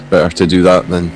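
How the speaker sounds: normal talking voice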